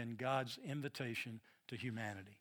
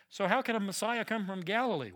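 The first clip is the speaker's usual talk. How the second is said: raised, louder scoffing crowd voice; mocking skeptic; 6 semitones higher, 5 dB louder than usual. Teacher voicing doubters scoffing at Jesus' hometown, mock incredulous. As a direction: sneer it like a doubting bystander.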